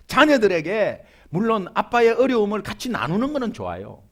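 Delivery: normal talking voice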